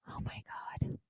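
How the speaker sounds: breathy aghast whisper. Real, not acted. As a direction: whisper it in disbelief. Aghast aside after rant.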